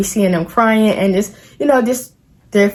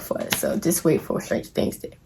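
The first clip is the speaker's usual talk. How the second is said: whispering; 5 semitones lower, 11 dB quieter than usual. Real, not acted. Closing aside, dropped to whisper.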